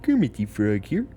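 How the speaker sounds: lightly voiced Kermit impression; a hint of Kermit's voice; Kermit the Frog. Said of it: "I got my Kermit the Frog shirt on".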